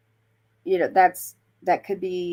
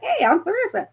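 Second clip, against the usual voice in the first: chirpy reply; 13 semitones higher, 3 dB louder than usual. Pretend. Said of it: "I'll be like".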